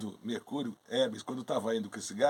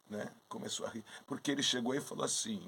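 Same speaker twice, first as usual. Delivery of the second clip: usual speaking voice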